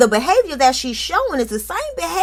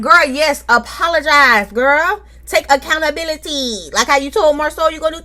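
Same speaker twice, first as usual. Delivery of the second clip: scolding; 5 semitones higher than usual. Real, not acted. Host lecturing Tisha to take accountability, raised voice. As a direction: lay down the law, take accountability.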